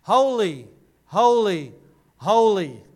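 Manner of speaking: each holy called out loud and long; ringing angelic call. Acted. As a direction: proclaim it like angels calling across the temple. Seraphim calling out holy.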